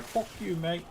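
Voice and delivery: high, soft mock-Australian jab; mock Australian accent. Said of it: "He's not Australian, by the way".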